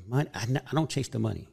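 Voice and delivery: usual speaking voice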